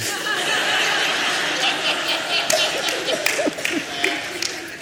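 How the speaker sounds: a long airy chuckle, high and unvoiced; breathy laugh at his own joke. Real, not acted. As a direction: laugh out at your own punchline.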